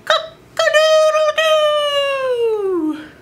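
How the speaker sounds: high, drawn-out cock-a-doodle-doo; a rooster waking the farm; rooster crow. Acted. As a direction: crow like a proud rooster at dawn. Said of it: "the rooster crowed".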